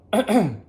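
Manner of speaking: harsh, loud coughing fit; real choking cough. Real, not acted. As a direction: cough hard, choking on food. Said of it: "on walnuts".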